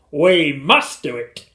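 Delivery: loud, slow, firm statement; deliberate, commanding dad; resolute father. A narrator's reading. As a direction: insist with full voice.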